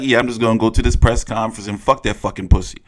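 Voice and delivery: low, fast tough-guy impression of Tony Harrison; a quick gruff impression of the fighter; mock Harrison voice. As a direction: rattle it off low like a cocky fighter.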